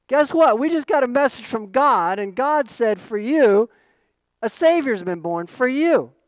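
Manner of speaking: loud, high, breaking the big news; excited proclamation. Acted. Shepherds passing on God's message, excited proclamation. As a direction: spread it eagerly to all who will listen. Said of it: "That's what they went and told people".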